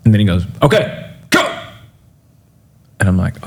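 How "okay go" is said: mock urgent go, pitched way up; retold cue. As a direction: cue the speaker like a producer. Buddy cues him again.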